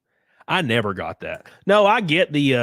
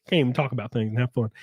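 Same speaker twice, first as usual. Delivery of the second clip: ordinary talk, nothing marked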